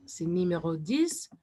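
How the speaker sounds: ordinary delivery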